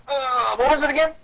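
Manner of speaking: put-on high-pitched kid trying to remember; acted puzzlement, pitched well up; forgetful child. Acts child forgetting the steps.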